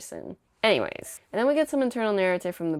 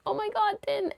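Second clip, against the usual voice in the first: mock alarm. Crowd reacts to Din fainting.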